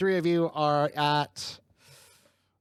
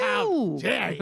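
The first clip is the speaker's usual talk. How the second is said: high, slow mock outrage; offended character voice; 9 semitones higher, slower than usual. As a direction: drag out the indignation. GM voicing offended Genuine.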